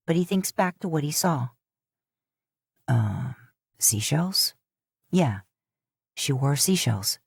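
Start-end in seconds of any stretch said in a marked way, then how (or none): 2.9-7.2 lower, lightly voiced man; low, hesitant male voice; a hint of an unsure husband answering; Dean's voice. A narrator's reading. Dean answers.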